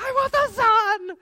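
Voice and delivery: hammed-up wailing child, very high and loud; mock bawl, dragging out the words; child's tantrum. Son keeps demanding the sun, hammed-up screaming tantrum. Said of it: "this kid's tantrum".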